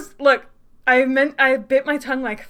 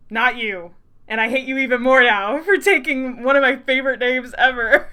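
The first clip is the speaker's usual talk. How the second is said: mock outrage; 10 dB louder than usual. Real, not acted. Loudly scolding character for the name.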